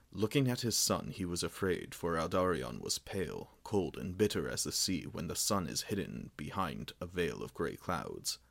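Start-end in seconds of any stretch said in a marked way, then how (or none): none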